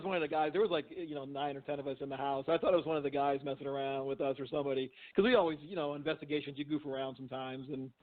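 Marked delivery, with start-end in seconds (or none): none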